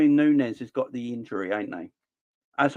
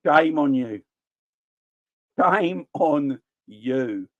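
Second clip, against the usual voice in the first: mock shaming; much slower than usual. Drawn-out mock scolding.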